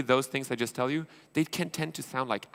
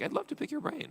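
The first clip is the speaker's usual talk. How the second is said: mock casual pitch.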